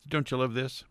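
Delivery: ordinary talk, nothing marked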